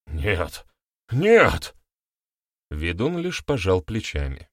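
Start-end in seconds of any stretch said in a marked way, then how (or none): 0.1-1.7 protest, rising into panic; horrified denial. A narrator's reading. Accuser hears his seven cursed lives, horrified denial.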